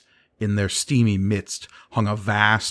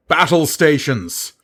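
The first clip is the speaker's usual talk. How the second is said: loud higher command; shouted order; 7 semitones higher, 6 dB louder than usual. A narrator's reading. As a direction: bellow the order down the tube. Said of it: "repeated the order into the tube".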